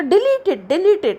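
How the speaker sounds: Delete pressed high, then falling; pressed stress. Shown as a demonstration. Teacher demonstrates focus for students, Delete stressed high. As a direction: hit Delete high and firm each time. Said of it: "delete में press कर रही हूँ, focus".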